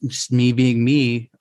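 normal talking voice

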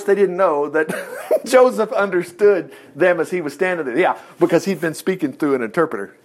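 ordinary delivery

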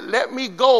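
pleading shout. Acted. Angel pleading in wrestle.

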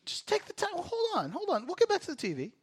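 high, soft imitated voice; mock pleading. Putting off the TV to ask questions, high mock pleading. Imitates child urged to wait.

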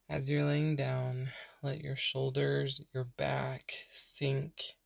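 unhurried meditation voice with long pauses; guided meditation. Real, not acted. Podcast host leading burned-out listeners into breathing, calm meditation voice. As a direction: softly guide them into rest, leaving space to breathe.

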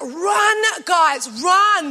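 shouted hurry-up call, pitched high; urgent shout. Retold or imitated. Husband hurrying wife through Dubai airport, urgent shout. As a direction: yell at them to run. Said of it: "as he yells back".

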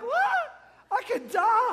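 high, alarmed yelp of disbelief; acted shock. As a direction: blurt it out high, rising in mock panic. Acted shock at grave diagnosis.

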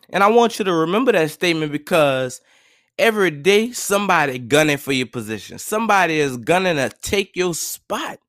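ordinary talk

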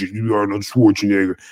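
mock muscleman. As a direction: lightly put on a low bodybuilder voice. Mimics gym guys chasing huge size.

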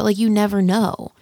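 normal talking voice

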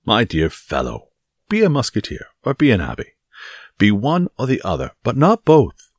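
emphatic lecture building to a loud finish; blustering Porthos, admonishing. Acted. Porthos tells Aramis to choose musketeer or abbé, admonishing. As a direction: lay down the choice firmly.

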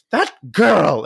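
a mother fed up with her daughter; mock-exasperated mother scolding.

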